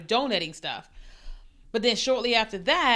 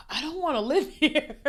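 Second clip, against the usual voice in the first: higher-pitched mock whine; retold whiny reluctance; 6 semitones higher than usual. Recalling her reaction to the awful house, whiny reluctance. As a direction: play up not wanting to stay, voice lifted.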